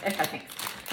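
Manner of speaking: whispered, dismissive thanks; quiet brush-off. Retold or imitated. To the butcher, ending the talk with a whispered thanks. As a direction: drop to a breathy aside as you wave him off.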